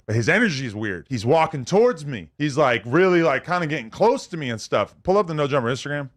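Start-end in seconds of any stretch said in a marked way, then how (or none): none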